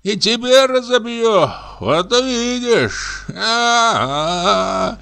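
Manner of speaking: put-on high, slow drunken ramble; drunk comic raving. Пьяный комик бредит. (пьяный бред, высоко и медленно)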